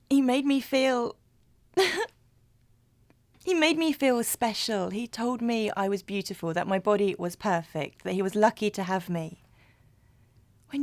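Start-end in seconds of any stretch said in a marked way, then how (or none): 0.1-4.9 higher, louder, with a little laugh; giddy fondness. Acted. Woman recalling the partner who made her feel special, giddy. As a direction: gush as if still smitten. Fondly remembers an adoring partner.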